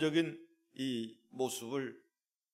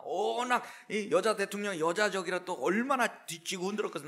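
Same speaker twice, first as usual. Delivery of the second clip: normal talking voice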